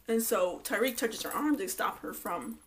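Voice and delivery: normal talking voice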